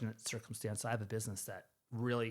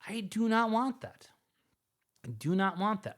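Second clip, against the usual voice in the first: "I do not want that" high and forceful; emphatic refusal; 8 semitones higher, 5 dB louder than usual. Real, not acted. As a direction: punch out "do not want", louder, each word firm. Emphatically rejecting being sole answer.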